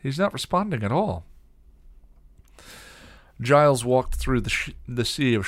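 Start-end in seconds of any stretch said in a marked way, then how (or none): 0.0-1.2 high, anxious tone; uneasy explanation; worried. A narrator's reading. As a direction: report it with mounting worry. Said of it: "explained worriedly".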